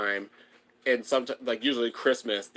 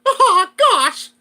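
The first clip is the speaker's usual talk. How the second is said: high, loud cartoon mouse, laughing; Mickey Mouse voice; 12 dB louder than usual. Shown as a demonstration. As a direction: squeak it out like Mickey. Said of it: "I can still do the Mickey voice".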